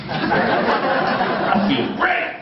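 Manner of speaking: high, very slow, breathy dazed voice; drugged bliss. Retold or imitated. Medicated patient in the ward, very slow and dazed. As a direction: float through it, blissed out on meds.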